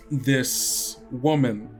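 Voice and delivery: ordinary delivery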